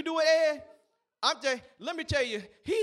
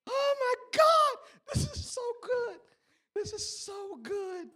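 overjoyed; 12 semitones higher, slower than usual. Real, not acted. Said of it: "they laughing as they tell a joke".

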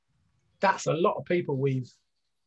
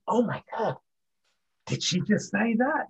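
higher, aghast oh my God; mock shock; 7 semitones higher than usual. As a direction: imitate stunned celebrities reacting, hammed up. Celebrities reacting to the old show.

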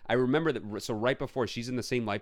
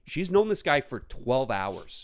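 louder, slow, indignant scolding; retold rant; 4 semitones higher, 4 dB louder, slower than usual. To Tina about the movie, exasperated slow lecture. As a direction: lay down the law to her. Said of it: "I'm like".

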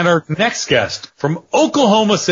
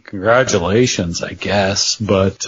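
lower, reluctant congratulations; grudging; 8 semitones lower than usual. Acted. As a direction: congratulate him through gritted teeth. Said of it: "I can't say I'm happy".

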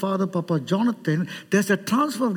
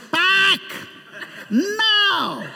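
retold shouted order; 10 semitones higher than usual. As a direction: bark it out as an urgent order. Retold order to wife to pack.

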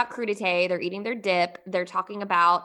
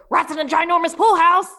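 ordinary delivery

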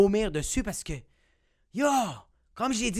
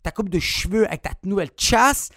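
ordinary talk, nothing marked

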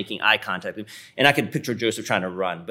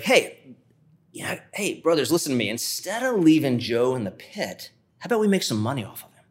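voicing Judah; 4 semitones higher than usual. Brother Judah pitching a scheme.

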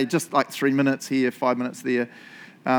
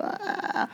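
exasperated sigh; 6 dB quieter than usual. Retold or imitated. Overwhelmed by growing to-do list.

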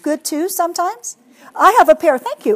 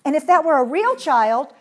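usual speaking voice

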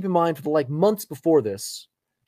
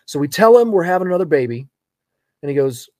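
ordinary talk

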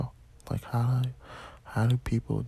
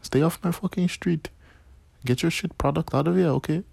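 high-pitched mock Indian roadman; Indian accent; 7 semitones higher, 5 dB louder than usual. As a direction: defend your turf in a put-on Indian accent. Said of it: "an Indian roadman".

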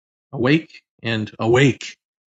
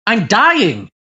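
panicked; 8 semitones higher, 6 dB louder than usual. Retold or imitated. Panic returns in vision.

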